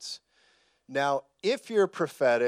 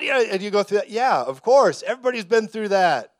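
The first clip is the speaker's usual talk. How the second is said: fired-up preaching voice, louder; 6 semitones higher, 8 dB louder than usual. Real, not acted. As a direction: preach it hard and loud. Preacher heats up.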